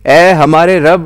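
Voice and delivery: high, loud invocation; crying out. A narrator's reading. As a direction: call out upward in appeal. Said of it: "وہ پکار اٹھیں گے".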